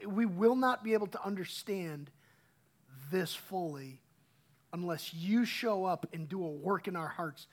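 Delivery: hushed, earnest prayer to God; a soft pastor's prayer before the sermon. Real, not acted. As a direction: pray quietly, asking God to speak.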